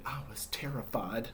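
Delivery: whispered confession of fear. Acted. Humpty confessing to children on the wall, whispered. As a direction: let the fear out in a hush.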